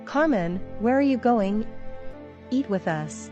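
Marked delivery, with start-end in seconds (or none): none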